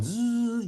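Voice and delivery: high buzzing "zzz"; sewing machine buzz. Acted. Storyteller imitating mom's sewing machine, high buzz. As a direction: imitate a little sewing-machine engine humming. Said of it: "you could just hear that little engine".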